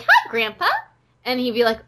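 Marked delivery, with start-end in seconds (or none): 0.0-0.7 put-on little kid voice. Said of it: "and I'd be like".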